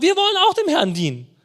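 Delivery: high, fast, loud eager vow; the people promising Josua, high and fast; eager crowd. Retold or imitated. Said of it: "Sie haben gesagt".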